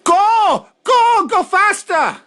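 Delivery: high, loud falsetto scream of go; mock screaming. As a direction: screech it like she's egging the boat on. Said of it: "Sofia Vergara's screaming in the background".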